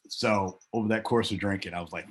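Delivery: normal talking voice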